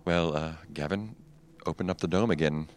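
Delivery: subdued order. Acted. Professor figure's subdued order.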